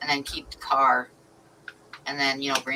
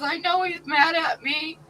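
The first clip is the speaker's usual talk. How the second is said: mock crying; 12 semitones higher than usual. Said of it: "she's like, crying".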